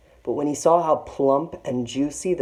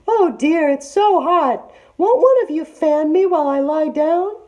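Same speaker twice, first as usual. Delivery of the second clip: sleepy fake auntie; 8 dB louder than usual. A narrator's reading. Disguised tiger voice.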